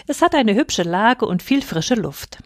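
ordinary delivery, nothing marked

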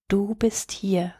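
breathy whisper, slow, barely voiced. A narrator's reading. Frightened boy under a blanket in a storm, whispering. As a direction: softly assure himself in the dark. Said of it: "flüsterte der Junge".

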